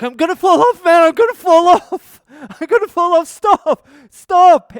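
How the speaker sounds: high, full-volume panicked yell; screaming. Retold or imitated. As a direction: scream for the bike to stop, voice way up. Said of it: "I'm screaming at him to fucking stop".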